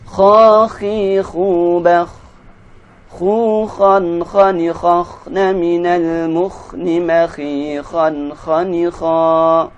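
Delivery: teacher modelling kha syllables; recitation drill. Shown as a demonstration. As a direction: chant each kha syllable clearly. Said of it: "drill syllables".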